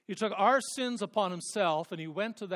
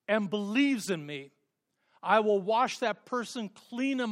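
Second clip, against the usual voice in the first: higher, louder rising proclamation; fervent preaching; 4 semitones higher, 5 dB louder than usual. Acted. Preacher proclaiming Christ's promise to congregation, rising fervent preaching. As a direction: build it like a promise shouted from the pulpit.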